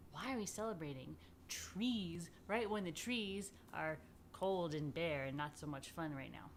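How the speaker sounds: usual speaking voice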